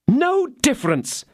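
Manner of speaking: high, slow, scornful cry; young wife crying out; cried out. A narrator's reading. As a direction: cry it out in contempt. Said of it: "cried the young woman".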